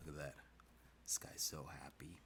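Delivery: soft, low whispered coo. Real, not acted. Artist admiring happy character, whispering. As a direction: whisper it fondly. Cooing over cheerful drawing.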